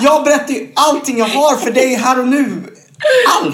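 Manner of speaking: high, laughing, tearful mock confession; mock oversharer. Stranger at a party confessing all, high tearful laugh. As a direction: ham up someone who spills everything.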